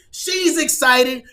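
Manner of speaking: usual speaking voice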